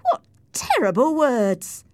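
high, loud reproach; scandalized exclamation; shocked cry. Acted. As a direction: cry out appalled. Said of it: "I cried".

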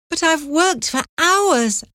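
put-on high, slow girl's protest; a hint of a hurt young girl. A narrator's reading. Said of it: "Anne said".

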